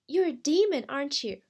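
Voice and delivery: loud, high accusing jab. Acted. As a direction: pounce on it as an accusation. Crystal accuses the unseen voice.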